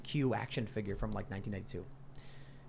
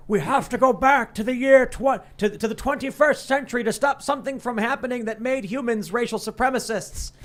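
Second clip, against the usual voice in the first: loud, high, hammed-up dramatic proclamation; Picard; 9 semitones higher, 7 dB louder than usual. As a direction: declaim it like an overwrought captain. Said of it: "And then Picard's like".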